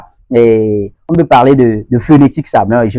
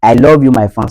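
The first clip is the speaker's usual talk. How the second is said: LOVE as "lawv" in a Nigerian accent. Shown as a demonstration. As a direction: Nigerian English with rounded "aw" in love. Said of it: "Ça, c'est du côté, eh, nigérian".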